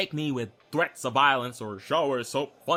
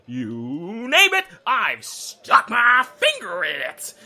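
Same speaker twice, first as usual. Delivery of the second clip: loud gleeful boast; 5 semitones higher, 8 dB louder, slower than usual. Acted. Boasting, building to a punchline.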